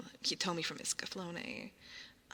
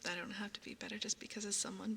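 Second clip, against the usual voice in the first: ordinary talk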